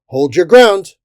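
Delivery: very loud, high command; shouted order. A narrator's reading. Soldier ordering comrades to hold, loud command. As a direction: order the troops to stand firm. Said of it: "a fifth man ordered".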